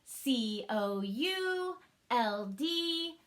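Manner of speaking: C O U L D spelled out slowly, one letter at a time; slow letter spelling. Shown as a demonstration. Teacher spelling could aloud for the class. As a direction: tap each letter out slowly. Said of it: "One more time".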